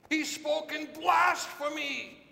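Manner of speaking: high priest faking shock, yelling blasphemy; outraged yell. Acted. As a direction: shriek it as feigned outrage, slowing down. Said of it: "and he yells".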